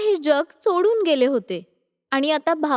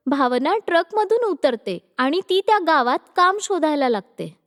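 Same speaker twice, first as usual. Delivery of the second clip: plain reading voice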